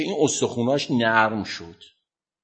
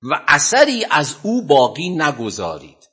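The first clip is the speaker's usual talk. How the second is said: raised, measured cry of command; retold shouted command; 4 semitones higher, slower than usual. Imam ordering two lions to seize the wrongdoer, mock shout. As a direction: call out the order slowly, pitched up. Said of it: "با فریاد".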